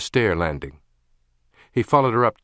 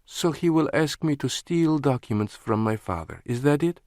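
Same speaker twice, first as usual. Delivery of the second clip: higher, mocking woman's voice; incredulous woman; 7 semitones higher than usual. A narrator's reading. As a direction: question it in disbelief. Lana, incredulous.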